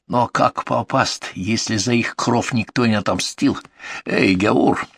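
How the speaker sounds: put-on menacing broken Russian, highlander accent; Caucasian accent. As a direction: lecture the prisoner in a Caucasian accent. Chieftain's long accented speech.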